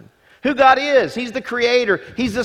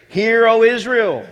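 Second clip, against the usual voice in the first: proclaiming; 4 semitones higher, 5 dB louder than usual. Acted. Proclaiming the Shema.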